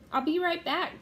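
loud, hurried reassurance; calling out. A narrator's reading. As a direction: call it back across a distance. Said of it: "Jack called".

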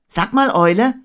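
put-on high, loud child character; Christopher Robin. A narrator's reading. Boy addressing his friend Owl, high and loud. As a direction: pitch it up and call brightly to a friend. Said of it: "sagte Christopher Robin".

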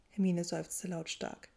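plain reading voice

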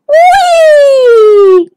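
very high, long, loud whee; gleeful cry. Acted. Dennis catapulted in bubbles, gleeful cry. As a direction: squeal it as you are flung along. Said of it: "cried Dennis".

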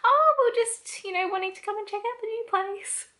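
high, sing-song fake-innocent voice; high teasing pretend-casual tone; mock casual high voice. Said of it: "so I'm like".

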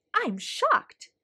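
a sudden high jump of surprise; shocked. Acted. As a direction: gasp it out, genuinely caught off guard. Principal startled by his dancing.

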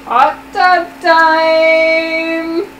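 hammy dramatic reading. Acted. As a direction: stretch it out like a party call. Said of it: "a dramatic reading".

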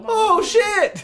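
very high, loud startled exclamation; shocked shopkeeper. Acted. Shopkeeper hearing customer was in the mines, high shock. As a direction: yelp it in shock, pitched right up.

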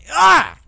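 short, high, loud cry; sudden startled outburst; startled yell. Real, not acted. Player caught off guard mid-game, startled yell.

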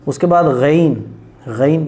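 ordinary delivery

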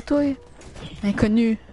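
ordinary talk, nothing marked

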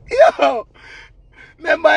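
ordinary talk

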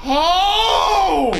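sudden loud yelp of shock; startled shout. Real, not acted. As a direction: jump at the scare. Startled by game scare.

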